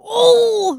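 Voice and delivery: high, loud "oh" yelp; demonstrated startled cry. Retold or imitated. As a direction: re-enact a sudden high yelp. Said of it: "like that".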